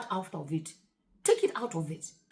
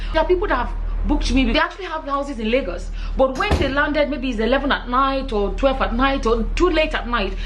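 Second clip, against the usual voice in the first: louder, emphatic sales pitch; promo ad read; 9 dB louder than usual. Real, not acted. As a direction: sell it with punchy stressed key words. Promotes her own shortlet.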